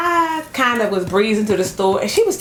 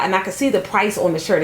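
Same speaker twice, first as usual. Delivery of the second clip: ordinary talk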